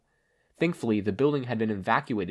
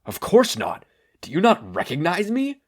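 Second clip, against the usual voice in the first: louder, offended gruff hero voice; 8 semitones higher, 7 dB louder than usual. A narrator's reading. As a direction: bristle at not being recognized. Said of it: "looked offended by that statement".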